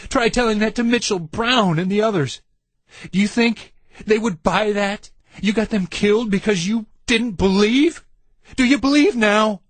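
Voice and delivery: mock the excuse to the whole world; sneering furious mockery; mocking fury. A narrator's reading. Randy mocks Lloyd's excuse furiously.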